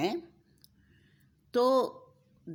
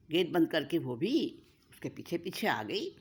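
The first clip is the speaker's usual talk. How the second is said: plain reading voice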